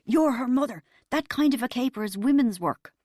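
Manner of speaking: indignant stage hiss. A narrator's reading. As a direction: hiss the blame back at your wife. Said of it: "And Dad hissed".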